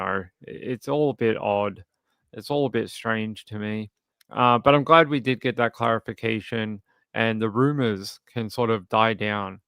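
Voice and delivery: usual speaking voice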